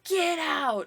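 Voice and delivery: imitating a stream alert yelling get out; mock yell. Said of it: "it yells".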